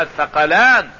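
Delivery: "الثقلان" as tha-qa-lan, short "a" first; fatha on the tha. Shown as a demonstration. Teacher repeating the verse to confirm the vowel, "الثقلان" with fatha on tha. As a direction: open the th of "الثقلان" with "a", not "i". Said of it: "بفتح الثاء".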